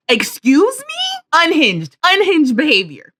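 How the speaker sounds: slow, high-pitched incredulous question; affronted and drawn-out; scandalized disbelief. Real, not acted. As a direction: draw it out, rising in indignant disbelief. Scandalized reaction to Mister Krabs.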